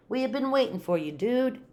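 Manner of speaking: high, quick character voice; Chihuahua amigo voice. A narrator's reading. Don Diego welcomes his amigo, high quick voice. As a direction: greet an old pal, pitched right up. Chihuahua friend greets the kitten.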